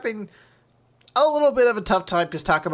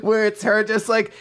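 ordinary talk, nothing marked

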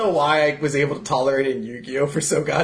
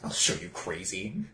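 hammed-up mad villain threat; dropping low into a mock-menacing threat; 3 semitones lower, 4 dB quieter than usual. Mock villain speech from a clip-like bit.

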